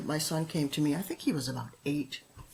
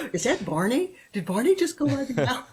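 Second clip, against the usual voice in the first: high, loud, laughing fake amazement; mock surprise; 7 semitones higher, 6 dB louder than usual. Shown as a demonstration. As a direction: gasp and feign delight to distract a toddler. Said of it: "you can distract 'em".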